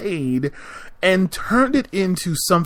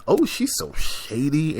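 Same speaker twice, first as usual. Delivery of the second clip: a lightly voiced catty gossip, a bit softer; mock gossip voice; 4 dB quieter than usual. Mocking friends who overuse shady, a light catty imitation.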